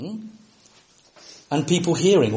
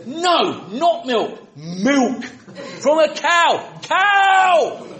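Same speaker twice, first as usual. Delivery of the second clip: shouting words; 13 semitones higher, much slower than usual. Retold or imitated. Tourist shouting at a French waiter, hammed-up yell. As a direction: shout it at someone who doesn't understand. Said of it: "shouting words at people".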